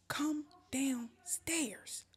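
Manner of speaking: very slow, soft, word-by-word command; measured order. Retold or imitated. Storyteller ordering friend to leave upstairs, measured. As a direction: spell out the order with restrained patience.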